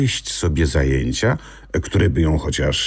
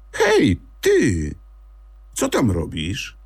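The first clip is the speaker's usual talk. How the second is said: high-pitched call from the treetop; 6 semitones higher than usual. Acted. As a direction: call down to the stranger below. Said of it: "zawołała".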